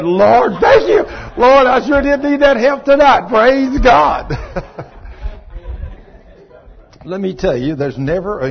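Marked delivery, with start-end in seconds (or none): 0.0-4.3 loud, high, jubilant thanks to God; joyful praise. Retold or imitated. Preacher reliving thanking God after wife's apology, loud and joyful. 4.4-5.9 breathy laugh. Real, not acted. Laughs after praise.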